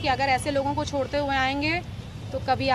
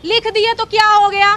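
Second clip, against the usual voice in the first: mocking the wife; 5 semitones higher, 9 dB louder than usual. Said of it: "उनकी बीवी भी कह रही हैं".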